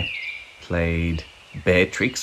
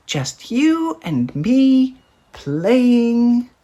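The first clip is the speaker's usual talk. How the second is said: much higher, very slow cub voice; a tiny cub savouring each word slowly; little bear voice; 13 semitones higher, much slower than usual. A narrator's reading. Little Bear's voice in story.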